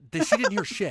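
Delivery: high, insistent exasperated cry; exasperated outburst. Real, not acted. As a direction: burst out insisting. Heated insistence.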